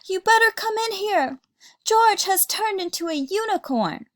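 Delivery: alarmed yell. Acted. Mom to Dad about George, alarmed yell. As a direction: shout in a fluster. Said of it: "Mom yelled".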